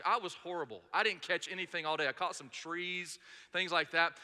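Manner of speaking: normal talking voice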